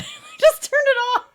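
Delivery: words squeezed out through a high laugh. Real, not acted. Laughing while admitting.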